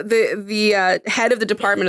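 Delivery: ordinary delivery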